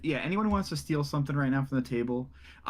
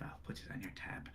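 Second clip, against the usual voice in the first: sneering aside; 16 dB quieter than usual. Acted. NPC sneers at Thrud.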